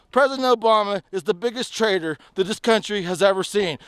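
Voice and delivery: normal talking voice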